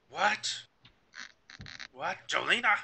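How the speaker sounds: high, loud outraged parent; yelling. Acted. Parent finds missing Jolena and orders her inside, yelling. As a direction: build from startled calls to a full yell. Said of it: "Don't yell at her".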